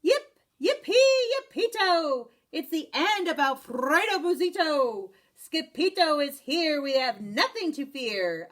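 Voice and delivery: high, loud celebrating chant; joyful cheer. A narrator's reading. The Chihuahuas cheer their new hero, loud joyful whoops. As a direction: whoop it like a party.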